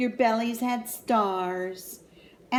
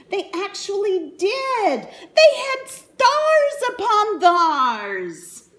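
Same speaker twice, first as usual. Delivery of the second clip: much higher, louder, slow triumphant; excited; 10 semitones higher, 9 dB louder, slower than usual. A narrator's reading. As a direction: burst out with delight. Excited climax to children.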